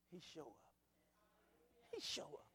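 soft, whispered he show up; hushed testimony. Real, not acted. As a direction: drop to a reverent whisper. Awed quiet testimony.

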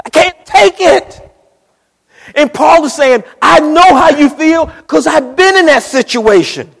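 high, loud cry of despair. Acted. Preacher crying out the sufferer's plea at full force. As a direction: break it out as a shout.

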